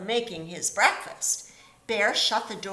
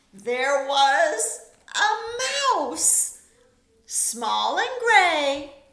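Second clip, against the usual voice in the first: stretched slow, higher and louder; slow storybook refrain; 6 semitones higher, 9 dB louder, much slower than usual. A narrator's reading. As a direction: savour each word of the refrain.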